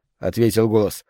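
ordinary delivery, nothing marked

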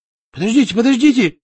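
high, loud, panicky cry; frightened plea. A narrator's reading. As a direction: beg them to stop in fright. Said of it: "испуганно выставил перед собой руки". (испуганная мольба)

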